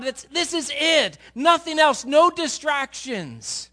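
high, loud, slow passionate preaching; full-force preaching. Acted. Pastor urging the congregation to live for God, preaching hard. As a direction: drive every word home to the pews.

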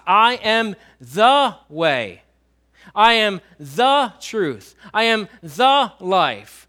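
very slow, with "the" drawn out and punched each time; "the" said loud and stretched, set apart by pauses; stress on "the". Shown as a demonstration. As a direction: lean long and hard on every "the". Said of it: "the little word the is before each one".

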